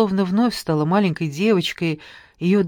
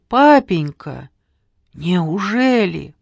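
slow, loud anguished cry; shocked, calling for her father; shocked gasp; 6 dB louder, much slower than usual. A narrator's reading. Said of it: "выдохнула Софочка".

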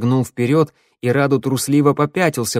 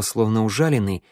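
usual speaking voice

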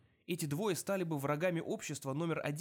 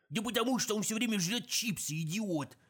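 high, loud exasperated bark; gruff gravelly dwarf voice; 7 semitones higher, 4 dB louder than usual. Acted. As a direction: snap at the idiots. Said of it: "рявкнул гном".

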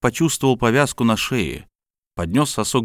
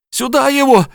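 hysterical woman; 6 dB louder than usual. Acted. Helena urges shoving him out.

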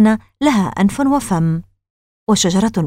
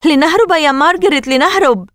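high, urgent plea to flee; panicked shout; 8 semitones higher, 4 dB louder than usual. Acted. Casey begging his sister to run, panicked shout. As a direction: cry out to her in fright. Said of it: "صاح كيسي".

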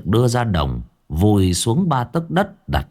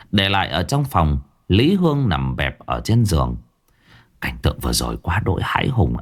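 usual speaking voice

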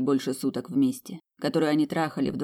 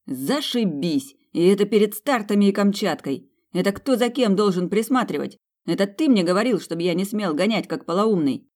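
angry rant; 5 semitones higher, 4 dB louder than usual. Acted. Brother angrily ranting.

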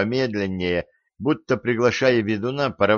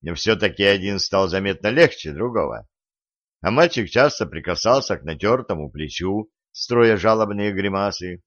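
plain reading voice

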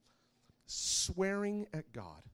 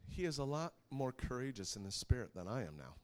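usual speaking voice

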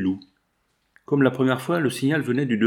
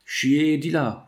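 raised-pitch sentry challenge; mildly acted sentry's cry; sentry's shout; 4 semitones higher, much slower than usual. A narrator's reading. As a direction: call out a challenge, pitched higher. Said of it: "cria la sentinelle".

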